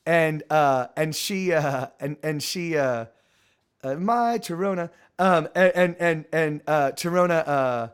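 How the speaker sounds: ordinary talk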